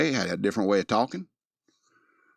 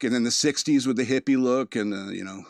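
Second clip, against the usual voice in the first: ordinary talk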